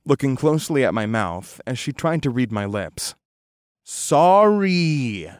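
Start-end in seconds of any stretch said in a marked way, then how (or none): 3.8-5.3 drawn out, louder, higher sorry; slow and loud. A narrator's reading. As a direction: stretch it out for lip reading. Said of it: "I repeated more slowly".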